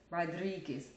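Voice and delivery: Rodriguez with the r rolled. Shown as a demonstration. Showing viewers she can roll the r in Rodriguez. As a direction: trill the r in Rodriguez. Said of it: "I keep doing it accidentally".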